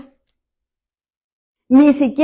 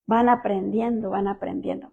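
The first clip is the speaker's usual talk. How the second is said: fading echo; 4 semitones lower, 9 dB quieter than usual. Shown as a demonstration. Repeats phrase, fading away.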